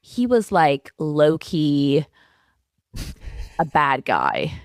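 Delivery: mock American accent, drawn-out "like". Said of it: "I like your American accent there".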